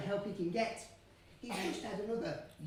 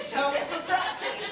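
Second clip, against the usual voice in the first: the same question called out higher and louder; louder repeat; 7 semitones higher, 8 dB louder than usual. Real, not acted. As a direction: call it again to wake the crowd. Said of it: "I said".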